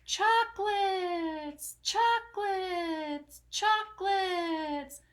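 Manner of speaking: high, slow, hollow echo; the fake echo luring the rat. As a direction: stretch each word like a cave echo. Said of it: "the voice from the cave replied".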